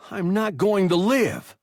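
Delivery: put-on high, loud whine from a hurt boy; boy calling out. A narrator's reading. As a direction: call it after him in a high whine. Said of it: "the boy called after Hertz".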